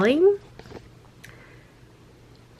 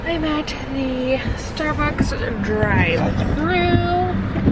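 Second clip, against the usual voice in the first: drawn-out slow announcement; slow drawl at the drive-thru; 4 semitones higher, much slower than usual. Real, not acted. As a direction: stretch every word lazily.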